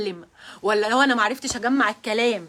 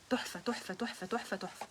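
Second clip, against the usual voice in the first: repeated praise getting much softer; fading praise; 4 semitones lower, 14 dB quieter than usual. Real, not acted. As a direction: let the praise fade to almost nothing.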